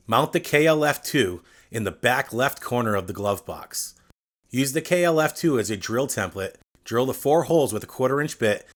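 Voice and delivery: usual speaking voice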